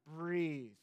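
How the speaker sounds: soft, hushed, soothing breathe; mild imitation of a calm coaching mom; calming a child. Wife soothing a screaming toddler, softly imitated. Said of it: "Allie, she'll just be like".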